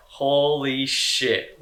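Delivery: very slow, drawn-out disbelief; slow-motion retold shock; stunned amazement. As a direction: stretch each word out in astonishment. Retold stunned reaction to the crash.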